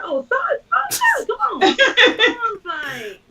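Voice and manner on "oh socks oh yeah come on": much higher, squealing "oh, socks"; imitating the office staff recognizing her; mock sudden recognition. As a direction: play the dawning delight of recognition. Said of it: "They're like".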